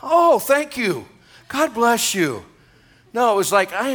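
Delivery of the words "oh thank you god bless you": mock sweet thanks. Young pastor mockingly thanks his critic, sugary. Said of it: "So what did I do?".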